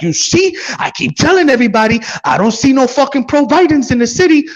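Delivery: high, loud retold exclamation; exclaiming outburst. Man telling the whole party he was right, high loud outburst. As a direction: pitch it way up, as if announcing to everybody. Retells his triumphant outburst.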